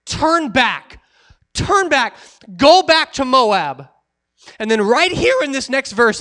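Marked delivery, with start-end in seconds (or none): 0.0-3.9 insistent urging. Acted. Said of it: "She says it multiple times".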